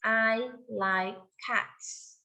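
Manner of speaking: very slow, every word drawn out; slow model sentence. Shown as a demonstration. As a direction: model it slowly for a child. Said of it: "that's good".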